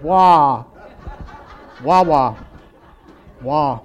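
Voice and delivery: loud laughing wah wah; wah-wah drone. Pretend. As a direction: honk it out like a muted horn. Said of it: "Just like Charlie Brown's teacher".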